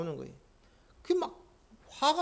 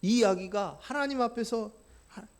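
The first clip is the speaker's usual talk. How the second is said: ordinary delivery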